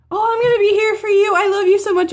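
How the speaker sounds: high, loud, emotional reassurance; gushing uncle. Retold or imitated. Uncle reassuring his niece, gushing and high. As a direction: pour out love before she can finish. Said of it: "he said".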